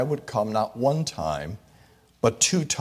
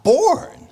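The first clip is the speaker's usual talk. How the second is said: born cried out much higher and louder; soaring, emphatic born; stress on born; 7 dB louder than usual. Real, not acted. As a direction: ring out the single word born. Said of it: "is the word born".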